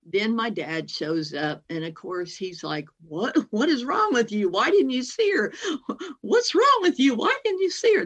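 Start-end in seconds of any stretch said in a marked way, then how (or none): 3.1-5.5 rising exasperated fatherly yell; angry dad. Retold or imitated. Dad scolding after the accident. 6.2-7.2 sharp high scolding question; loud high exasperated demand; angry dad. Retold or imitated. Dad's question at full force. 7.2-8.1 rising exasperated fatherly yell; angry dad. Retold or imitated. Dad scolding after the accident.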